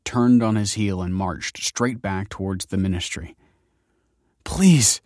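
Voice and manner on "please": high, loud plea; urgent, falling plea; imploring. A narrator's reading. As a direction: implore him not to go. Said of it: "implored Michael".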